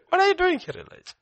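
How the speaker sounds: very high, loud question dropping off; incredulous questioning. Acted. God challenging Elijah at the cave, incredulous questioning. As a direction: pitch it right up in disbelief.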